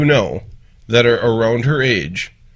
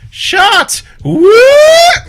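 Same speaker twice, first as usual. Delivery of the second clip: put-on high squealing drunk girl yell; shrill party girl shout; 10 dB louder than usual. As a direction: scream it like a party girl at the bar. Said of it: "that one girl that goes".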